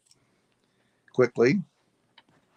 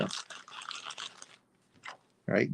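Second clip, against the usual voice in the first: breathy whispered all right to herself; whispered aside; 2 semitones lower, 12 dB quieter than usual. Real, not acted. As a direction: whisper it under your breath, drawn out. Muttered aside before next card.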